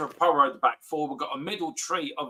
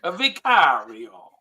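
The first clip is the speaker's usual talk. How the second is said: slow, drawn-out Italian "Vicario"; hammed-up Italian accent; 3 semitones lower, 4 dB louder, slower than usual. Shown as a demonstration. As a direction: stretch "Vicario" like a mock Italian commentator. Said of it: "with the Italian accent".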